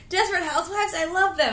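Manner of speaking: excited. Retold or imitated. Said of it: "he's like".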